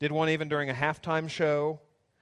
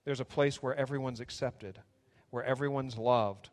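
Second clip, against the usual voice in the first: soft, hushed prayer; 3 semitones lower, 4 dB quieter than usual. Acted. Pastor praying over the church, soft and earnest. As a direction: pray gently over the church.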